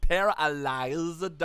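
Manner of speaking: "paralyzed" loud and very drawn out; overdone pronunciation. Acted. Narrator corrects a fumble, "paralyzed" slowly overenunciated. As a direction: stretch every syllable of "paralyzed". Repeats the fumbled word emphatically.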